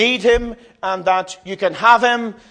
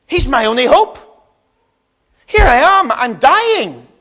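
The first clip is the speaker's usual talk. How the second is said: loud, high pleading shout; desperate cry; 7 semitones higher, 7 dB louder than usual. Acted. Blind Bartimaeus must meet his only hope, desperate cry. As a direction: cry out for help at full pitch.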